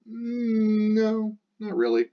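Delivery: very high playful no; squeaky mock reply; mock answer. Shown as a demonstration. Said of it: "yes or no question".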